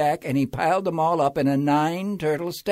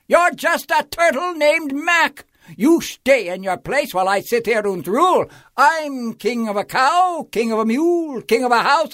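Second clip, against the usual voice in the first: bellowing King Yertle; 10 semitones higher, 4 dB louder than usual. A narrator's reading. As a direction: bray your greatness at the turtle below. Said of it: "he bellowed and brayed".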